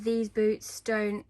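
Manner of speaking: normal talking voice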